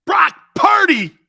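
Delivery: loud, high, slow mock cheer for "Brock Purdy"; mock hype shout. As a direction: shout the name like a fake superfan. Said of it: "I can't even say that with a straight face".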